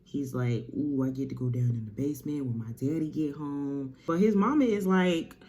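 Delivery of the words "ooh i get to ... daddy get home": ordinary delivery, nothing marked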